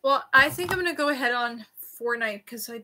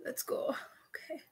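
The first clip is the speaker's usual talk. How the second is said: whispering; 3 semitones higher, 12 dB quieter, slower than usual. Real, not acted. Bracing for piercing.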